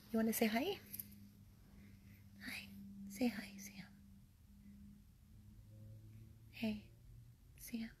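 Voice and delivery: soft whispered coo to the dog; high, hushed pet talk; tender whisper. Real, not acted. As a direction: lie down and hush to him gently.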